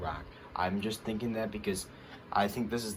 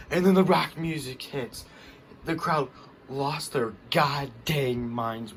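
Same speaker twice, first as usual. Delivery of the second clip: louder and higher, thrilled; excited; 5 semitones higher, 5 dB louder, slower than usual. Real, not acted. Fan recapping The Rock's entrance, excited and loud. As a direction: hit it like the big moment of the story.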